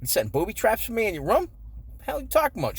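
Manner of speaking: lightly voiced mom accusing her son; imitating suspicious mom. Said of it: "my mother's like".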